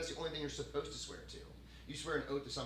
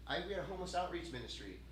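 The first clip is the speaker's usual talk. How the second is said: ordinary talk, nothing marked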